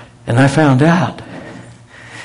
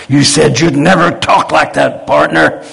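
louder Texan scolding, punching never; Texan friends scolding; 4 semitones higher, 5 dB louder than usual. Retold or imitated. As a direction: call him out, partner, loud and firm.

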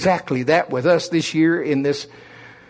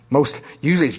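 normal talking voice

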